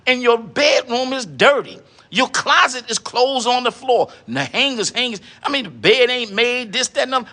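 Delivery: normal talking voice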